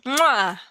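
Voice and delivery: loud smacking air kiss; goodnight kiss. Acted. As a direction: blow a big kiss to the mic. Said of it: "I'm giving you a kiss".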